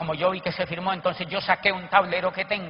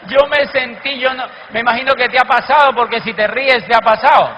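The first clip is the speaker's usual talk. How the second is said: loud, laughing admission to the crowd; 9 semitones higher, 14 dB louder than usual. Real, not acted. The speaker admitting an embarrassing moment to the audience, loudly laughing.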